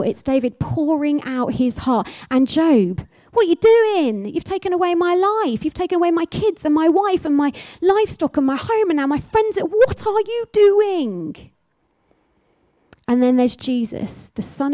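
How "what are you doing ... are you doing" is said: raised pitch, pleading lament; high anguished cry of a man who lost everything. Acted. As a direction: cry out to God as Job, bereft and accusing. Voicing Job's complaint to God.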